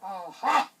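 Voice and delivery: loud, booming lion's aha; a lion delighted by its catch. A narrator's reading. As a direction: pounce on it like a big cat.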